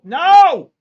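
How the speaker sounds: high, loud cry of no; a desperate loud no; anguished shout. Real, not acted. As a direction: wail it in despair. Trade turning against him.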